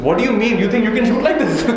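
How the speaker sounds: mock offended archer; lightly hammed-up irritation; irritated archer. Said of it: "this guy gets very irritated".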